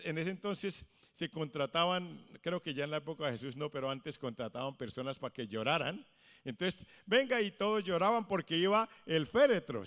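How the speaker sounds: usual speaking voice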